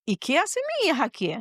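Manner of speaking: mildly taken aback, pitch lifted; retold alarmed question. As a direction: re-enact a worried mother's disbelief. Retold alarm at intensive care door.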